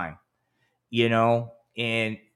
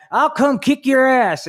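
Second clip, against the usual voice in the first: tough guy threat; 12 semitones higher, 7 dB louder, slower than usual. Retold or imitated. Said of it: "I had these guys".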